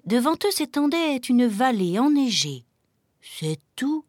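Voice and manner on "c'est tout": small, understated letdown; terribly disappointed. A narrator's reading. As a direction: say it softly, let down. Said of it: "dit Velours, terriblement déçu". (petite voix déçue)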